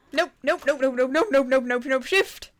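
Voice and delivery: rapid, loud, frightened nope chant; panicked nopes. Real, not acted. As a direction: panic as danger closes in. Panicked while chased.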